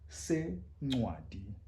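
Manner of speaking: Sencwadi very slow with the c click; isiXhosa click. Shown as a demonstration. Teacher modelling Sencwadi slowly. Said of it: "title of what?".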